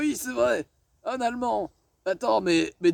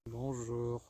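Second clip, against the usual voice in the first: deep, soft and slow dopey voice; very low slow voice; 9 semitones lower, 12 dB quieter, slower than usual. Acted. Sketch guy playing the Ottoman, very low and slow. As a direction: sink low and drag it out.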